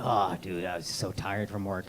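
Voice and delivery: low, soft mocking whine of a tired radiologist; whiny tired husband. Comic mocking the sister's radiologist ex, low whiny voice. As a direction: mumble it like a spoiled man complaining. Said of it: "He'd be like".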